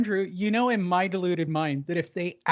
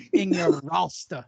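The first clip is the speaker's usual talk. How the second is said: mock English accent; 2 semitones lower than usual. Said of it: "I wish I could do an English accent".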